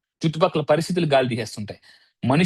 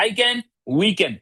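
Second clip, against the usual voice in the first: very slow, higher, drawn-out chant; slow affirmation; 4 semitones higher, much slower than usual. Shown as a demonstration. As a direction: stretch each word like a team mantra. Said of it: "ఏంటి affirmation".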